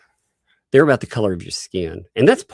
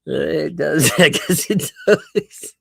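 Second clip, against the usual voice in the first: laughing admission; 7 semitones higher, slower than usual. Real, not acted. Laughing at own arrogance.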